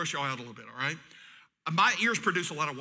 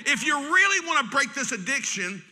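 louder, higher preaching push; preaching shout; 6 semitones higher, 5 dB louder than usual. Acted. Pastor urging addicts to change, loud preaching. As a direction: drive it home to the church.

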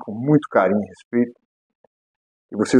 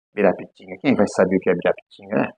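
"Ibirapitinga" said low and soft, much quieter; soft aside; 5 semitones lower, 6 dB quieter, faster than usual. Shown as a demonstration.

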